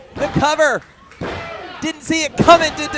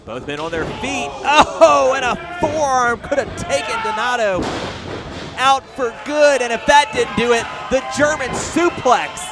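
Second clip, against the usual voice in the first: rising excited play-by-play call; wrestling commentator; 3 semitones higher, 3 dB louder than usual. Real, not acted. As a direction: call the big moves louder and higher. Live wrestling play-by-play build.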